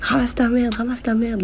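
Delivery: high-pitched yell at little volume; mock shouted order. Soldiers ordering the old witch to clean up, high mock yell. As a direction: imitate soldiers shouting a repeated command. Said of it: "ils lui crient de ramasser".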